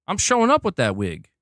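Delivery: ordinary talk, nothing marked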